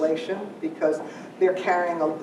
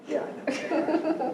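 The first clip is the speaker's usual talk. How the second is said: laughing; 11 semitones higher, slower than usual. Real, not acted. Laughing at own weirdness.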